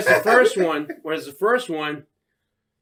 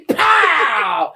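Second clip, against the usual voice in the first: shouted pow; 7 semitones higher, 6 dB louder than usual. Retold or imitated. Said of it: "He's just like".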